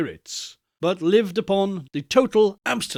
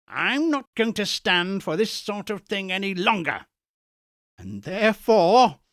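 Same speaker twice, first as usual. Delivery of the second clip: higher, building mock-stern employer; mock-stern Scrooge, rising; 3 semitones higher than usual. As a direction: Scrooge teases Bob, winding up toward his surprise. Scrooge feigning sternness to Bob.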